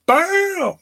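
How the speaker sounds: very high, punchy "Bam!"; a comic burst of a catchphrase; mock exclamation. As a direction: blurt it out in a sudden squeal. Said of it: "The first thing she goes is".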